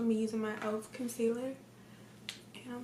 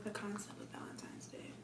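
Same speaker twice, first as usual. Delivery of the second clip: whispering; 9 dB quieter than usual. Real, not acted. Whispered while blending.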